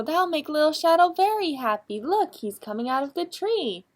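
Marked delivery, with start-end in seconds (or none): none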